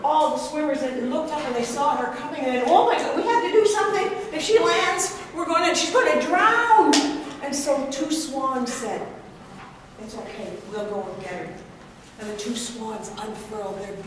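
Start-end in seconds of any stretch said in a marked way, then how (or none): 2.7-6.9 fast, higher and louder alarm; urgent, we have to act; panicked. Acted. Sea animals panicking as the woman falls. 10.0-11.5 soft, calm reassurance; gently, it's all right; reassuring. Acted. Swans calming the others before rescuing her.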